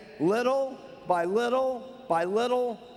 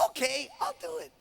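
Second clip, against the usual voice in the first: high, soft, squeaky little acceptance; very excited; 8 semitones higher, 6 dB quieter than usual. Acted. Mary agrees to try the diet, quietly thrilled. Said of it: "She's very excited".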